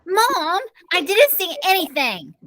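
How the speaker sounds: high-pitched protesting screech. A narrator's reading. Sister protesting to mom, high screech. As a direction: screech your innocence. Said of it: "Zipper Nose screeched".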